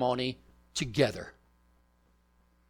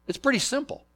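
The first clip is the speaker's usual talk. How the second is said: "pretty" lifted much higher the second time; higher repeat; 9 semitones higher, faster than usual. Shown as a demonstration. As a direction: say "It's pretty simple" again, pitched up high.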